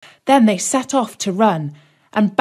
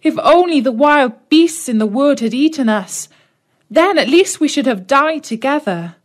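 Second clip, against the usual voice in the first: put-on higher, mildly tearful girl; a touch of despair, pleading; Gretel lamenting; 6 semitones higher, 3 dB louder than usual. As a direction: Gretel wishing they had died together.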